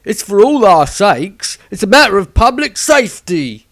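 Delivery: loud, rough villager, rising into a rant; a zealot justifying the burning; loud righteous mob man; angry villager. Acted. Villager voiced in a story.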